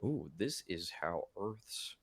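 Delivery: slow, soft report at first; lightly voiced alien observer taking notes; alien scout. Caster as alien scanning Earth for the mothership, measured report. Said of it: "like an alien, like you mentioned".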